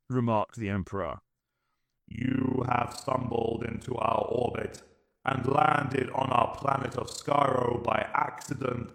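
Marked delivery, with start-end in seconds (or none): 2.1-8.9 slow, cold robotic alien voice; Dalek voice. A narrator's reading. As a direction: speak like an unfeeling machine. Emperor speaks.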